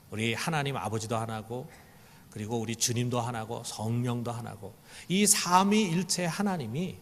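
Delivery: ordinary talk, nothing marked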